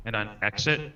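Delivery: normal talking voice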